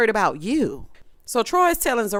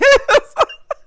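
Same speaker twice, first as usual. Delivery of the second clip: high, loud burst of laughter; cracking up at the self-checkout mess; 8 dB louder than usual. Real, not acted. Host losing it over the checkout, loud laugh.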